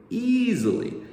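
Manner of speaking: high, loud, excited easily; excited high emphasis. Acted. Repeats easily, second high excited.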